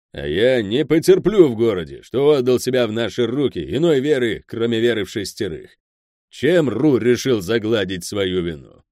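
loud, emphatic boss laying down the law; stern anger. Acted. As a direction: refuse to tolerate it, forcefully. Crime boss lays down his law.

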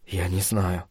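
very soft, low murmur; whispered reply. A narrator's reading. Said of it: "прошептал в ответ Брэдли". (тихо, почти шёпотом)

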